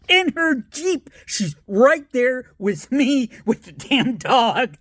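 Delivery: high, slow, dragged-out dismay; mock dread. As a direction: stretch it out like doom arriving. Retelling getting caught, exaggerated.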